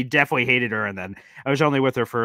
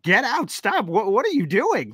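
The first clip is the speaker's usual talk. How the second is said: high mock scolding voice; scolding parents; 8 semitones higher than usual. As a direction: play the appalled parents shooing him. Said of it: "they're like".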